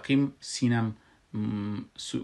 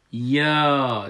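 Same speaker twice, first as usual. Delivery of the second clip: یا held long and loud; long vowel; 2 semitones higher, 7 dB louder than usual. Shown as a demonstration. Teacher counts the second madd, یا drawn out loud. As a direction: stretch the "aa" of یا slowly. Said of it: "دیگه مد".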